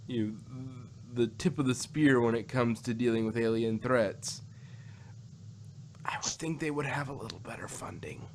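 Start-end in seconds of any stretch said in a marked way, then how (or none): 6.1-8.3 hushed, wry whisper; dry whispered aside. Real, not acted. Podcast host to co-host, mocking UNIT's budget in a wry whisper. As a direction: let it slip out as a sly, breathy jab.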